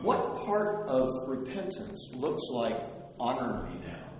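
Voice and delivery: normal talking voice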